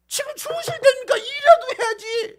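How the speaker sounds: high, fast, loud nagging shout; angry demand. Acted. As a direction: yell at them to get up and work. Acted angry waking of Jesus. (높고 빠르게 다그치는 고함)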